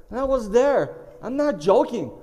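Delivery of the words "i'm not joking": normal talking voice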